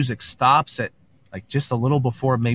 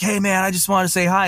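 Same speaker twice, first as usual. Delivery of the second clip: higher-pitched eager newcomer imitation; excited greeting; 7 semitones higher, faster than usual. High-strung guy introducing himself to everybody, imitated excitedly. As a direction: imitate someone buzzing to say hello. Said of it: "He was so excited".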